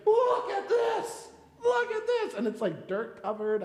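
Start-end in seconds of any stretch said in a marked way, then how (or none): 0.1-2.3 much higher, louder, slow, laughing squeal; a thrilled boy showing off his find; excited child. Retold or imitated. Father imitating son with dirty rocks, laughing high squeal.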